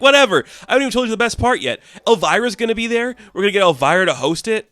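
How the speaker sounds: high, fast, giddy sales pitch; excited Harley Quinn. Retold or imitated. Harley Quinn pitching her party to the cast, giddy and high. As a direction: gush the whole plan in one breath.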